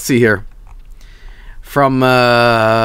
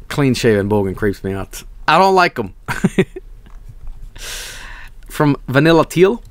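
ordinary talk, nothing marked

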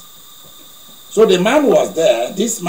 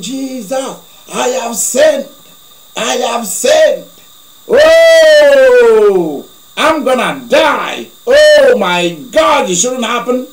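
higher, slow, hammed-up wailing; retold panicked shout; 5 semitones higher, 5 dB louder, slower than usual. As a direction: play the terrified sinner, drawn out. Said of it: "He started shouting".

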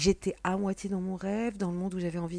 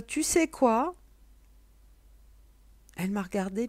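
ordinary talk

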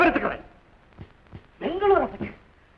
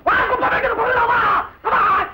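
high-pitched shouted dare; angry challenge; 12 semitones higher, 3 dB louder than usual. Acted. Quarrelling man daring the other to come out, angry. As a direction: goad him to step outside, shouting.

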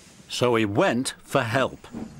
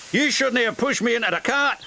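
huffy; 10 semitones higher, faster than usual. A narrator's reading. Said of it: "huffed Donald".

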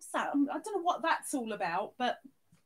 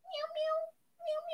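high, soft little meows; cat meows; 12 semitones higher, 7 dB quieter than usual. Pretend. Streamer meowing the cat emoji for viewers. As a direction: mew like a kitten.

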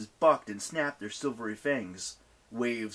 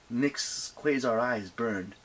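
ordinary delivery, nothing marked

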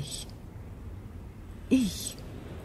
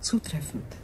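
alien whisper, breathy and hushed; eerie whisper; 3 semitones lower, 10 dB quieter than usual. Acted. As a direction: breathe it like an unearthly being.

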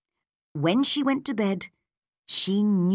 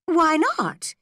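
much higher and louder, sharp question; astonished; 11 semitones higher, 4 dB louder than usual. Acted. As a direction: demand to know, startled. Anne's astonished reaction.

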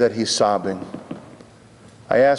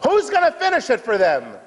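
high start on WHO'S, falling to the end; fervent high-pitched appeal; impassioned repeat; 12 semitones higher than usual. Real, not acted. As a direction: cry the question out to the congregation. Rhetorical question repeated with force.

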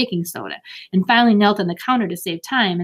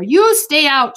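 high, loud, firm command; ordering; 7 semitones higher, 7 dB louder, slower than usual. A narrator's reading. Beezus ordering a parent out of the kitchen, bossy. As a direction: order them to keep out. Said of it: "she ordered".

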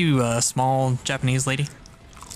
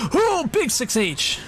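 excited; 12 semitones higher, slower than usual. Real, not acted. Hype call on a big hit.

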